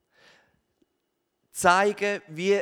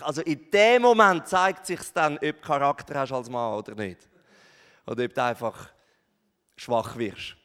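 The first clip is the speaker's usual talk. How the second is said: usual speaking voice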